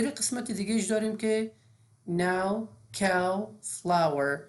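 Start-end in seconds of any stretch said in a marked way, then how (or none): 2.1-4.4 "now, cow, flower" with an open ah-oo glide. Shown as a demonstration. Teacher showing the second OW sound, AU as in "now".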